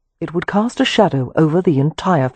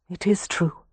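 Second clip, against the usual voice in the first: quiet admission; 2 semitones higher, 6 dB quieter than usual. A narrator's reading. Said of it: "she said".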